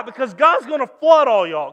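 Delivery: loud, emphatic shout; shouting warning. Acted. Noah warns his neighbors, shouted. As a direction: Noah booms the warning at them.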